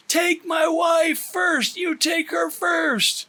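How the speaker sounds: high, slow, desperate scream; husband screaming at the officer. Acted. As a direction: scream it over the storm. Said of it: "screams at Paul".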